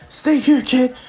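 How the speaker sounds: high, mock-urgent call; urgent command. Gran Torino ordering Izuku as Nomu attacks, urgent.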